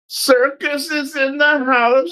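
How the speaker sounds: high, drawn-out singsong holler; mock call-out. Mock-calls out streamer greeting.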